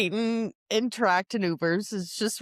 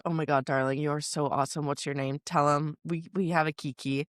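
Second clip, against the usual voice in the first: camp stranger voice; 7 semitones lower than usual. Retold or imitated. The pool man gushing back at his fan, lower campy voice. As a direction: do the pool guy, low and fabulous.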